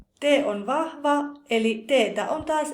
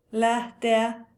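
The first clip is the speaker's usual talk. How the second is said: ordinary talk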